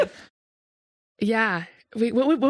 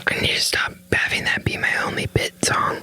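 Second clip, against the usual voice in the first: whispering. Real, not acted. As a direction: keep it to a close whisper. Whispered bit continues.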